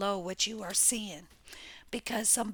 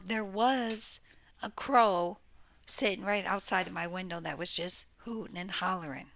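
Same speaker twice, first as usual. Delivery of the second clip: ordinary delivery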